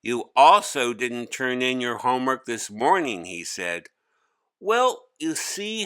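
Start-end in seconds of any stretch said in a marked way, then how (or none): 0.0-3.2 much higher and louder call of the name; a teacher summoning a pupil firmly; stern teacher. Acted. Teacher pressing Hari. 4.6-5.8 put-on much higher, louder boy's whiny complaint; a kid groaning under a heavy load; young boy Hari. Hari starting an excuse.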